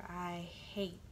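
usual speaking voice

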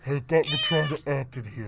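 lightly ghostly villain complaint; a hint of the spooky put-on voice; Kylo Ren. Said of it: "your Kylo voice is very similar to your ghost voice".

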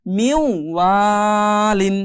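"মিউ" glides nasally into a long "ওয়ালিন"; slow, drawn-out "মিউ ওয়ালিন" with a held w; merged ghunna. Shown as a demonstration. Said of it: "ওয়াওটা মিলাবো".

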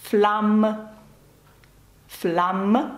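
"Флам" with an oral A and sounded M; non-nasal vowel. Shown as a demonstration. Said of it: "Це не носовий звук".

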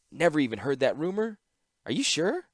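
rising high, teasing female voice; mocking disbelief. Ashnod taunting Tawnos about the rumor, mocking and high. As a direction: needle him with mock surprise.

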